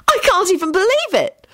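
high-pitched delighted disbelief; excited high cheer; happy call. A narrator's reading. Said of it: "Hermione called out happily".

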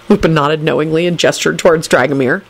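ordinary delivery

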